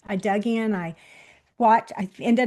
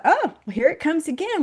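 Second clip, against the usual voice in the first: higher-pitched "here we go"; retold dismay; 7 semitones higher, 4 dB louder than usual. Driver seeing a car about to T-bone them, retold dismay. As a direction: brace for it, voice lifting.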